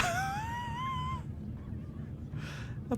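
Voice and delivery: high whooping laughter; shrieking laugh. Real, not acted.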